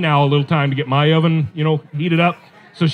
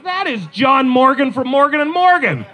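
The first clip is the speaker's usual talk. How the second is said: high-pitched, incredulous husband; hammed-up exasperation; appalled disbelief; 8 semitones higher than usual. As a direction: protest in exaggerated disbelief, pitch jumping up. Husband appalled at wife's crush.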